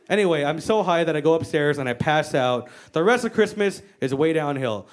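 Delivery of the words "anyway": normal talking voice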